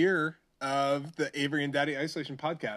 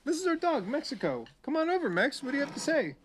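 sing-song voice for the dog; high pet-talk voice; 11 semitones higher than usual. Acted.

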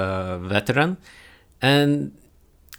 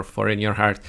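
ordinary delivery

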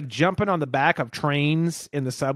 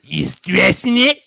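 louder, slower mock question in his friend's voice; hint of a New York punk's drawl; imitating Jim; 3 semitones higher, 8 dB louder, slower than usual. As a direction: lean in and ask it like the buddy would. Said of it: "he'd be like".

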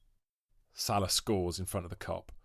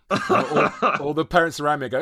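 laughing; 6 semitones higher, 10 dB louder, faster than usual. Real, not acted. Laughing while telling it.

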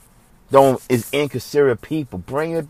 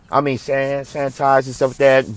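ordinary talk, nothing marked

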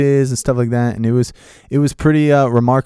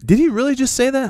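very high, incredulous retelling; mock outrage squeaking up high; irate. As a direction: act out indignant disbelief at a high pitch. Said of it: "he just starts getting irate".

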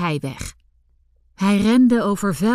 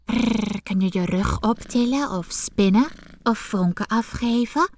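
purring cat. A narrator's reading. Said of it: "zei de kat".

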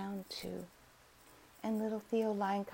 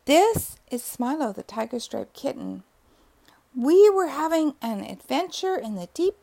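louder, earnest little cub; eager lion cub; 10 dB louder than usual. A narrator's reading. Lion cub explains to the dog, loud and eager. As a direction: tell it all bravely but tired.

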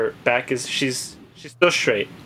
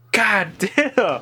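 shocked god damn; 6 semitones higher than usual. Retold or imitated. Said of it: "I'm like".

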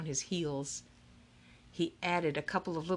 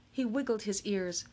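usual speaking voice

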